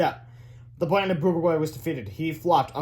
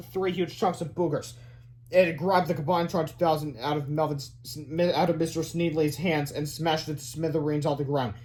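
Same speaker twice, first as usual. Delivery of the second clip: ordinary delivery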